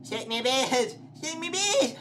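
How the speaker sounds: loud shouts climbing high; frantic shouting. Real, not acted. Player yells at game monster, frantic shouting.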